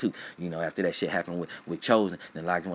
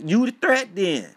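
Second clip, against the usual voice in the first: mock yell; 12 semitones higher, 7 dB louder than usual. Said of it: "Like Unc said".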